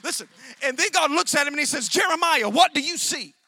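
loud, surging pulpit delivery; full-force preaching. Acted. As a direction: drive the story home from the pulpit. Preaching Jeremiah's calling.

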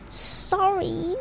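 soft tearful sob of a word; sobbing sorry. A narrator's reading. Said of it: "sobbed Hagrid".

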